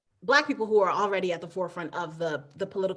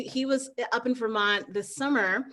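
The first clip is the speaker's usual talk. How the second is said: usual speaking voice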